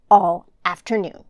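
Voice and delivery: usual speaking voice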